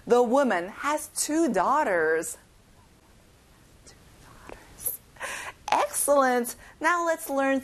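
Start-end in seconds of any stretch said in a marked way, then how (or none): 0.1-2.4 slow, clear teacher model; slow model reading. Shown as a demonstration. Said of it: "let's say it one more time". 3.9-5.0 whispered repeat. Shown as a demonstration.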